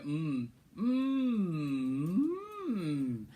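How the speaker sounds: closed-mouth hum; humming. Shown as a demonstration. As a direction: hum it, lips shut. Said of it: "Humming is when you shut your mouth".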